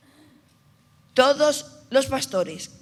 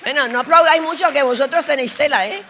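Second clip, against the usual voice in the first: teasing call over applause; 4 semitones higher, 11 dB louder, faster than usual. Real, not acted. Joking over congregation's applause.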